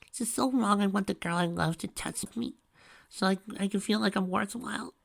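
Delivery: high, pleading, near tears. A narrator's reading. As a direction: beg through tears, voice up high. Pinky's tearful plea.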